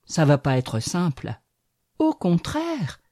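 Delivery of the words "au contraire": au contraire sung out much higher and slow; the little ash pipes up high to contradict; young tree retorts to the fir, high and slow; bright retort. A narrator's reading. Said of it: "répliqua le petit frêne".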